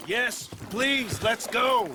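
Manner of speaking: urgent shout. Acted. Arthur shouting to leave the swamp after the attack, loud urgent plea. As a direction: push them to get out of here now.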